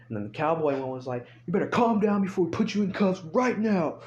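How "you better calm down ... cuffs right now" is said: high, forceful cop command; threatening order. Retold or imitated. Cowboy-looking cop warning the owner of cuffs, threatening order. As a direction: imitate a cop laying down a final warning.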